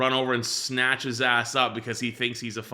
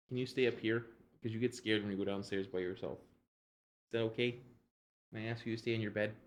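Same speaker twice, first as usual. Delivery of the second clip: gentle coaxing; 8 dB quieter than usual. Real, not acted. Gently settling dog before work.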